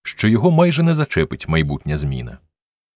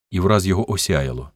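plain reading voice

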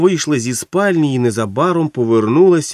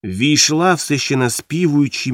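ordinary delivery

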